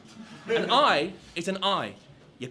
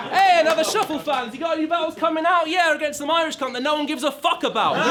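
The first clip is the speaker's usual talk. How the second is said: higher, fast eager fan voice; mock fan; 7 semitones higher, 4 dB louder than usual. Fan asking about upcoming battles, high and eager. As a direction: chirp it like a keen fan. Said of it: "mocking fan exchange".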